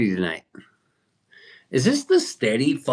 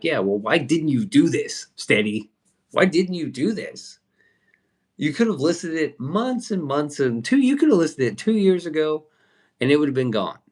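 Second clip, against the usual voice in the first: exasperated; 6 semitones higher than usual. Retold or imitated. Retold argument with Steady.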